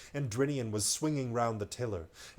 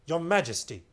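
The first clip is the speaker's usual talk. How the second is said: louder appeal; 5 semitones higher, 7 dB louder than usual. Acted. Mouse addresses king, louder.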